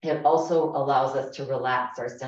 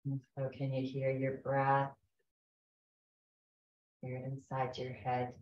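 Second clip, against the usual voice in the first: guided meditation. Acted. Leads a guided breathing meditation.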